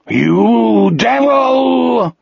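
very long, high wail; weeping scream. A narrator's reading. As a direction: howl it through tears. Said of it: "Wolf screamed, weeping".

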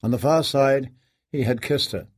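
ordinary delivery

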